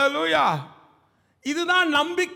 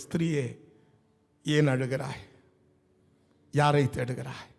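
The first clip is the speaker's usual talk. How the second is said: very loving, affectionate; 10 semitones lower than usual. Acted. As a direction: ask gently, far lower, with pauses between phrases. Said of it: "ஒரு பாசத்தோடு, ஒரு கரிசனையோடு".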